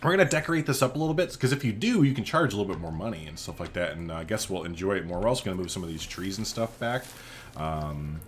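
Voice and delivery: ordinary talk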